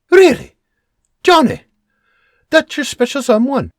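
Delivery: Really shot up high and loud in disbelief; hurt, high-pitched disbelief at her father; incredulous. Acted. Daughter in loud high disbelief at the man's joke.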